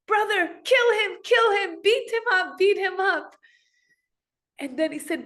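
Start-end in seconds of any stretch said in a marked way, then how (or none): 0.1-3.3 very high, loud shrieking urge; screaming sister. Retold or imitated. Subhadra eggs on her brother, very high shriek. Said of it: "She was screaming".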